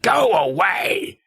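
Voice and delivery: high, drawn-out shout; acted yell, dragged slow; mock shout. Retold masked character ordering someone off.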